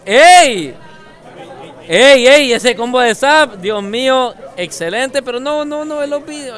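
loud, high hype commentary; hyped shouting. Real, not acted. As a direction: scream with excitement at the combo. Hyped by combo on stream.

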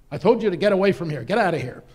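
loud, fast, irritated shout; angry innkeeper. Acted. Innkeeper shouting down at the traveller to leave, loud and fast. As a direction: bark him away from the door.

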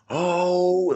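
high, loud, drawn-out crowd "oh"; imitated crowd reaction. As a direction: mimic a whole crowd reacting to a burn. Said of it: "everybody was like".

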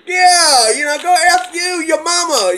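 high, jeering heckler voice; mocking atheist heckler. Atheists flipping off the preacher, high mocking jeer. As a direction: sneer it way up high like a taunting heckler. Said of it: "these atheists were like".

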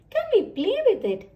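fast, high, eager ask; eager squirrel. Acted. Squirrel Tag begging Henny to play, quick and high. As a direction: rush the question like a keen little squirrel. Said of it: "asks Tag".

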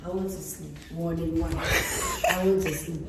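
usual speaking voice

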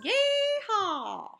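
high, loud yeehaw; cowboy yell. Acted. Piggy in a cowboy costume cheering Gerald up, loud high whoop.

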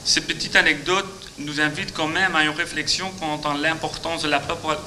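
ordinary talk, nothing marked

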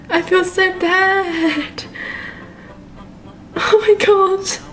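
real half-laughing, half-crying upset; teary cry. Real, not acted. Gamer wailing over her dead virtual pet. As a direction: voice cracking high with shock and tears. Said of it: "I'm, like, getting teary".

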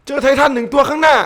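high, loud yell cracking hoarse; hoarse shout. Real, not acted. Gamer calling out a titan ahead, hoarse shout. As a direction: call it out till the voice goes hoarse. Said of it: "โอ้โห เสียงแหบ".

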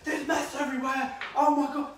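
high, loud mock wail of dismay; hammed-up flap over the chaos; behind-the-scenes panic. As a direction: imitate a frantic cook losing it. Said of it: "They probably are like".

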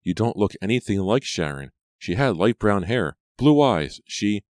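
building agitation, cut short; protesting. A narrator's reading. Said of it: "Brady protested".

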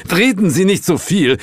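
gruff impatient interruption; blustering boss. A narrator's reading. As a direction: bluster at a dawdling subordinate. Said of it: "polterte Sir James".

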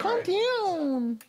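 high, drawn-out Karen voice; Karen impression. Teasing a friend's "calm down", mock Karen voice. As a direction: stretch it out high like a put-on Karen. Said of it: "That was my Karen impression".

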